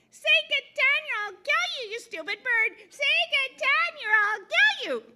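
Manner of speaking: very high, loud squawking parrot threats; parrot screeching. Acted. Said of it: "the parrot stood in front of it saying".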